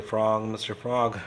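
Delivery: usual speaking voice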